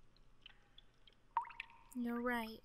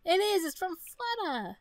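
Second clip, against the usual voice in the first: delighted exclamation; 9 semitones higher, 7 dB louder, slower than usual. Real, not acted. Delighted at happy thought.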